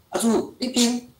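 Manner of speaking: slow, lightly voiced classroom call; calling on little 阿珠 to spell; teacher calling a pupil. Teacher role-playing a call on pupil 阿珠, slow. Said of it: "这个是阿珠啊".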